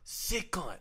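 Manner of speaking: high, loud mock-Australian exclamation; Australian accent. Shown as a demonstration. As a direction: exclaim it like an Aussie mate. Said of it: "I can't do Australian anymore".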